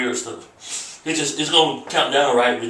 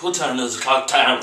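usual speaking voice

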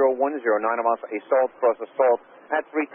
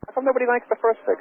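pitched up, half-joking exasperation; exasperated question; 12 semitones higher than usual. Real, not acted. Controller teasing the pilot over the skipped fix, exasperated. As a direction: sound fed up that everyone skips it.

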